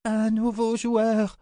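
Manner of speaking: very high, drawn-out wail; entranced high-pitched proclamation; deranged cry. A narrator's reading. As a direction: cry it out high like a seer in delirium. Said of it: "cria Ulissia".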